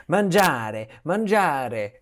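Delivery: put-on calling out in a light Italian accent; mock Italian. Hungry tourist in a tiny town begging for food, in a light Italian accent. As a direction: call out hopefully for food in Italian. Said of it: "walking around being like".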